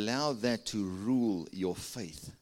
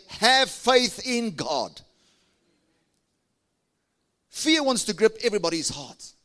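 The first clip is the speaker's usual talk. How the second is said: louder, higher preaching push; fiery preaching; 9 semitones higher, 7 dB louder than usual. Acted. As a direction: drive it home to the congregation. Preacher presses the point.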